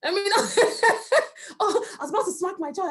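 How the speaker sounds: tickled, laughing as you tell it. Real, not acted. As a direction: laugh your way through the quote. Laughs retelling what parents tell her.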